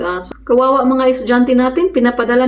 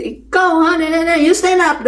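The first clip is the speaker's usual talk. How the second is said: high scolding teacher voice; 8 semitones higher than usual. Retold or imitated. Teacher ordering Marilyn to stand up, high scolding. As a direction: call the child out, pitched up. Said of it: "teacher scolds the child".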